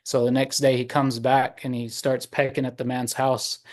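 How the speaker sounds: plain reading voice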